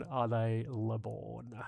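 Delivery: slow mock French "le born". Telling a French pun to chat, slow mock French.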